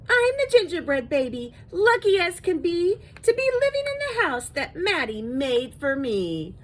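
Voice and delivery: high, loud little sing-song voice; gleeful storybook chant in a tiny voice; tiny gingerbread baby voice. A narrator's reading. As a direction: chirp it out high as a tiny cookie. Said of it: "could hear the tiny voice from inside".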